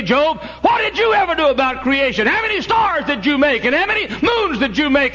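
high, loud preaching shout; God thundering at proud Job; thundering rebuke. Acted.

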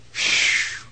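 acted whoosh of relief; relieved phew. Fugitive just inside the city of refuge, exhaled phew. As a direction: blow out breath, safe at last. Relief after reaching safety.

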